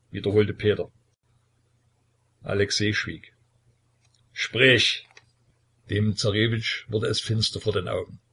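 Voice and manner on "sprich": barked command, louder; a father's harsh one-word command; commanding order. A narrator's reading. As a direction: snap the order at him. Tsar demands answer.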